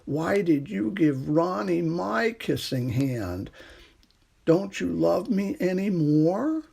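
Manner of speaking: a little raccoon asking through tears, slowly; saddest voice. A narrator's reading. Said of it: "in the saddest voice his mother had ever heard".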